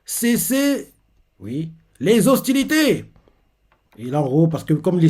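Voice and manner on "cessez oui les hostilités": much higher, slow, drawn-out order; hammed-up stern command to cease fire; commanding call. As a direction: call them off from afar. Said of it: "Il les appelle".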